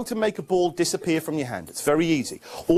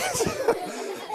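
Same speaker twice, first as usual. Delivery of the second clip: laughing; 5 dB quieter than usual. Real, not acted. Laughs at helper.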